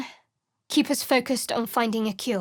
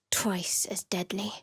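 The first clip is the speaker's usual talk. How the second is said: whisper; 3 semitones lower, 6 dB quieter than usual. Acted. Spell incantation.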